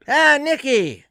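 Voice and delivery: high, loud happy greeting; delighted mock Norm; Norm's voice. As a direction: greet an old friend.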